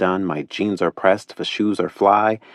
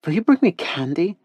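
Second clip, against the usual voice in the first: put-on cute little girl; 11 semitones higher than usual. A narrator's reading. Caro asks Teddy about massages in a cute little-girl voice. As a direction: ask like a curious small child.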